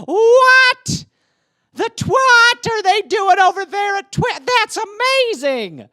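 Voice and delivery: very high, loud shriek of delight; shrieking amazement. Retold or imitated. Kid losing it over the new Twister, very loud and high. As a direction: scream in disbelief.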